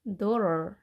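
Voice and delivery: "daughter" said like dorer. Shown as a demonstration. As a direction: roll past the middle of "daughter" with an R, no T. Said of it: "R 발음에서". ("daughter"의 T를 R로 부드럽게 발음)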